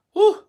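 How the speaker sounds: a loud high exhale of relief; high whew. Real, not acted. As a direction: blow out a tired whew. Exhales after long rant.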